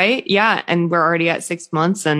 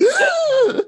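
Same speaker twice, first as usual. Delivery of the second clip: sharp high-pitched gasp; horrified gasp. Acted. Mom acting out her reaction to leaving her baby, dramatic gasp. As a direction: gasp in mock panic. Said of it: "And I'm like".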